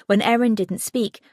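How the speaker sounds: plain reading voice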